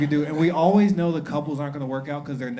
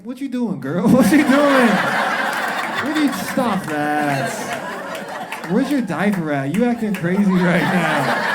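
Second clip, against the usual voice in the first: high, loud playful protest; loud, high-pitched comic fussing; mock scolding; 6 semitones higher, 10 dB louder, slower than usual. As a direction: holler it in mock outrage. Acted scolding of [name].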